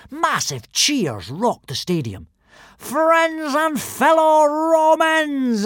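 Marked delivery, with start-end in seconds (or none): 2.8-5.7 high, slow, booming proclamation; villain's yell. A narrator's reading. Alvin proclaims to the stadium in a slow, high yell. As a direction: Alvin hails the crowd from the pavilion, yelling. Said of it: "yelled Alvin the Treacherous".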